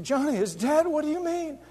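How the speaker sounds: high, cracking disbelief; stunned disbelief. Retold or imitated. Son hearing his brother died, high and stunned. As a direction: echo the news as if it can't be true.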